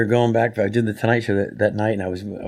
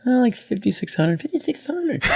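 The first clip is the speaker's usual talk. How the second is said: self mockery; 13 semitones higher than usual. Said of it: "I always say".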